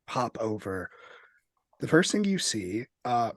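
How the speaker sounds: ordinary talk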